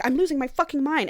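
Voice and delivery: retold frantic outburst. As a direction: act out coming a little unhinged. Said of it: "like, a psychotic break".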